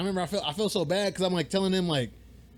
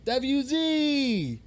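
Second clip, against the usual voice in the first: much higher, louder fan calling out; eager stranger; 8 semitones higher, 3 dB louder, much slower than usual. Retold or imitated. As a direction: call out the shirt's letters like an overexcited fan. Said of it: "He goes".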